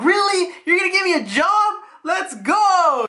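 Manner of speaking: put-on high, excited kid voice; hammed-up high-pitched cheering; thrilled kid. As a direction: squeal like a kid handed a dream job. Kid thrilled at job offer.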